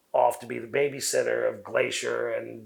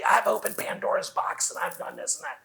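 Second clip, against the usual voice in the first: rushed original delivery; 9 semitones higher than usual. Shown as a demonstration. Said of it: "my original delivery was probably".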